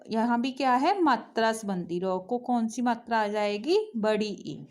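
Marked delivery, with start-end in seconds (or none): none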